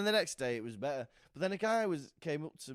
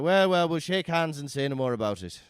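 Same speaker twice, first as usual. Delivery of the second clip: louder, hearty handshake offer; mock hearty settlement; 10 dB louder than usual. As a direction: boom out the peace offer. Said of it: "He's like".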